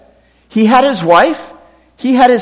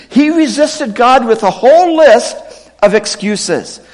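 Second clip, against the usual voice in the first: usual speaking voice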